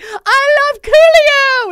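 high, loud, slow and stretched; gushing fan. Retold or imitated. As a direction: belt it out like a giddy fan. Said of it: "I'm like".

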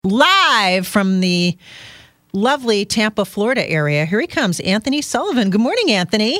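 big showman announcement; announcer intro. Real, not acted. Host introduces live guest loudly.

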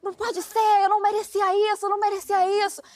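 high yelling in despair; retold yelling. Angry driver shouting to himself, a high despairing yell. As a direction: cry out that you didn't deserve it. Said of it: "E ele gritava".

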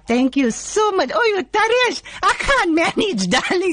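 man's high falsetto auntie; high, singsong gushing auntie; female auntie voice. Acted. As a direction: squeal it like a flattered auntie. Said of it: "from a male voice you change into a female voice".

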